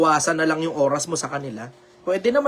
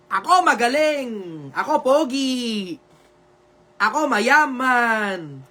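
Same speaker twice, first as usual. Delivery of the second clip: higher and slow, a bragging voice; mock self-praise; 4 semitones higher, much slower than usual. To his viewers, mocking the self-praise he never does, higher and slow. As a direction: ham up a boastful show-off. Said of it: "kailan yung panahon na pinuri ko sarili ko?".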